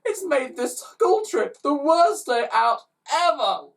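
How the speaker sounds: high loud slow wail; child yowling in tears. Acted. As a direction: drag out the misery. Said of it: "yowled a fifth".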